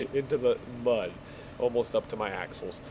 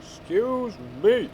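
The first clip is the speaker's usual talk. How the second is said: higher, louder, slow drawn-out excuse me; exasperated call-out; 10 semitones higher, 4 dB louder, slower than usual. Real, not acted. Gamer calling at a car in the way, drawn-out shout. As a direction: stretch it out like you're calling to a driver.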